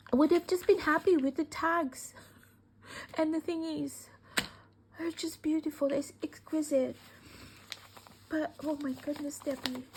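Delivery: overjoyed thanks to the sender; gushing delight. Real, not acted. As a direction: pour out the joy. Gushing with joy over gifts.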